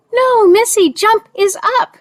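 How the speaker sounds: high, loud retold scolding; acting out an exasperated rider's loud correction; exclaimed correction. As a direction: call it out sharply, pitched well up. Rider scolds her failed jump.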